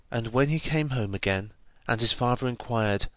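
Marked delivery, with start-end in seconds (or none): none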